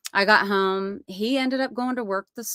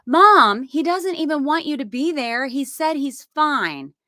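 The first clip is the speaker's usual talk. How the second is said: higher and louder, slowing at the end; insistent daughter; 7 semitones higher, 4 dB louder than usual. Retold or imitated. Tori insisting to mom that Dalton is fine, higher and exasperated. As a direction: push back like an exasperated daughter.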